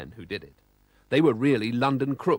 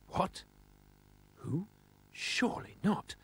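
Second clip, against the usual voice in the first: astonished whisper; 7 semitones higher, 7 dB quieter, slower than usual. A narrator's reading. Astonished at the monster.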